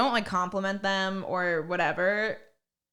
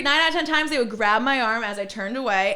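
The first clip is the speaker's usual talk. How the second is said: ordinary talk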